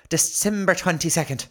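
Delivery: high, hammed-up bad Irish; awful Irish accent. Reading the diary date again, awful Irish accent. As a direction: announce it in a terrible Irish accent. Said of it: "Street urchin and awful Irish".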